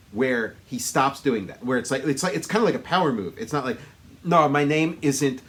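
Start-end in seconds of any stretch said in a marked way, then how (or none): 4.2-5.4 thick mock Austrian accent; Schwarzenegger. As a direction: introduce yourself in a hammy Austrian accent. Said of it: "he talks the way he does".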